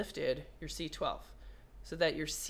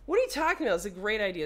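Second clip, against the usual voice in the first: mock indignant; 5 dB louder, much faster than usual. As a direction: act offended at the doubt. Mock indignant reply to Aaron.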